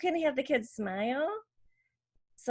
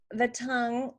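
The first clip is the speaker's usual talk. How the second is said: ordinary talk, nothing marked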